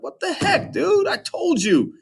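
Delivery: squeaky falsetto mock anger; a high, cartoonish cry of annoyance; teacher playing an exasperated Odysseus scolding his crew; mad Odysseus. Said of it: "and he's mad".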